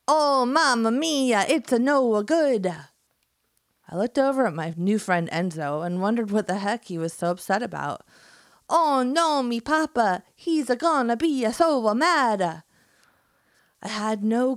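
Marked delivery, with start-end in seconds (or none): 0.1-2.7 hammed-up Italian accent, high and loud. Enzo upset, in a hammed-up Italian accent. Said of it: "read ... in my amazing skills for accents". 8.7-12.6 hammed-up Italian accent, high and loud. Enzo upset, in a hammed-up Italian accent. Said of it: "amazing skills for accents".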